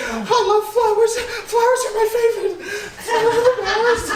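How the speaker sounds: florist voice. Acted. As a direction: gush about flowers like a dainty florist. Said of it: "just speak like a florist".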